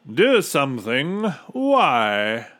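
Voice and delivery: loud, amused questioning echo; hearty, untroubled character voice; smiling captain. A narrator's reading. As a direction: toss it back as a smug question. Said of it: "smiled the captain".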